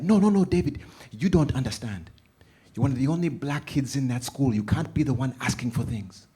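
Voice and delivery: urgent motherly lecture; firm mom. Retold or imitated. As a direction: lay down the law. Mom explaining firmly.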